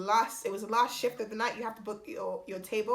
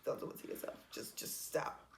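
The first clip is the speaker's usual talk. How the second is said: whispered aside; 12 dB quieter than usual. Real, not acted. Muttered aside to viewers.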